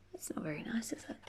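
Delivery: hushed whisper aside. Real, not acted. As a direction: whisper it like a secret.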